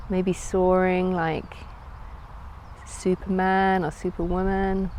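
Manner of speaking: calm, unhurried guided meditation voice. Acted. Yoga teacher leading listeners into a floating visualisation, calm meditative delivery. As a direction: guide them inward with long pauses between phrases.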